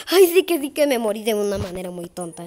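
ordinary talk

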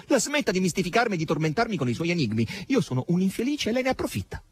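quick, high-pitched bitter protest. A narrator's reading. Margherita accuses Asasello of tormenting her, bitterly. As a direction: protest hurriedly, pitch climbing. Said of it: "con un sorriso forzato e amaro".

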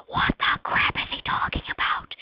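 voiceless, incredulous mock whisper; whispered disbelief. Classmates reacting to the Minecraft answer, whispered disbelief. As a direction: imitate baffled classmates in a full whisper.

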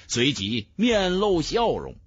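ordinary delivery

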